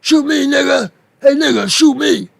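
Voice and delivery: high-pitched mocking impression, daring and loud at the end; mock taunting man. Imitating the man who got shot daring the shooter, high mocking taunt. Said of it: "the guy that says".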